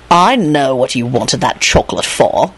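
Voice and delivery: low, slow, insolent needling; insolent reply. Acted. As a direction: needle him slowly in a low voice. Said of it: "Lupin replied insolently".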